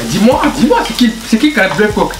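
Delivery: excited announcer. Acted. Host-style intro call.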